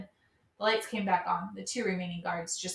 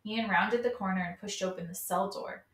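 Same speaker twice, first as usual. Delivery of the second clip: usual speaking voice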